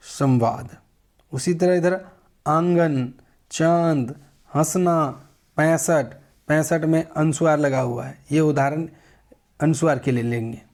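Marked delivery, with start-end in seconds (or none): none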